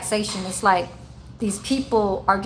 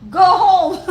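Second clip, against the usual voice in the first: loud, high-pitched mock holler; Su Ming; 9 semitones higher, 9 dB louder than usual. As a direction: yell it in playful imitation of an impatient resident. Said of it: "He be like".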